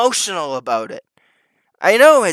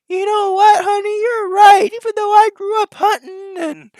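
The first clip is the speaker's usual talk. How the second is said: much higher, whiny voice of a dad caving to his wife; mocking meek dad; 4 dB louder than usual. Streamer mocking a dad who obeys his wife, high whiny imitation. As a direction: play the henpecked husband, squeaky high and giving in. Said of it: "the dad, who's fucking cucked ... will go".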